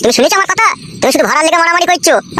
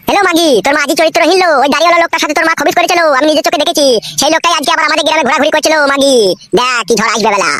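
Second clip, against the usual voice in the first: ordinary talk, nothing marked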